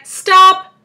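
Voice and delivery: high, very loud panicked protest; crying out. A narrator's reading. Earthworm interrupting James's plan, crying out. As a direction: shout to halt it at once. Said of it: "cried the earthworm".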